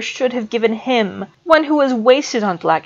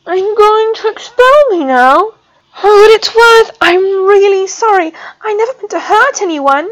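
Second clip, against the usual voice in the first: much higher, louder, crying plea; tearful plea; 12 semitones higher, 9 dB louder than usual. A narrator's reading. Student pleads with the professor, crying. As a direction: beg not to be expelled.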